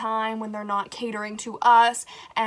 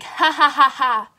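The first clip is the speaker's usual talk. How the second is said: demo laugh; 4 semitones higher, 8 dB louder than usual. Shown as a demonstration. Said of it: "that's the volume we got to".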